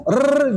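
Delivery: long trilled "rr"; too many taps on RR; over-rolled R. Shown as a demonstration. Teacher warning against over-repeating, long rolled R. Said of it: "jangan banyak-banyak".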